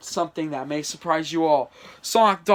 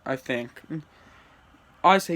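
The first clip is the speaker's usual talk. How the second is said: ordinary delivery, nothing marked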